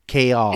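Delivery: "Kale" drawn toward two syllables; "Kale" as kay-ol; attempted pronunciation. Shown as a demonstration. Host struggles with a corrected name, "Kale" as kay-ol. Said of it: "I still can't say it right".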